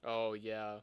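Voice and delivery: ordinary delivery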